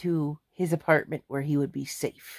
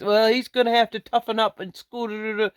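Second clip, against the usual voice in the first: gruff dad; 5 semitones higher than usual. Retold or imitated. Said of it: "I said".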